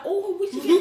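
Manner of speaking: high, loud laughing mimic; imitating Aisha. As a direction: squeal it like the friend. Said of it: "She's like".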